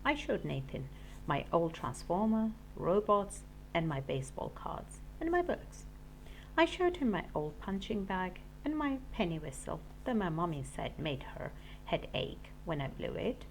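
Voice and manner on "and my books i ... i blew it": ordinary delivery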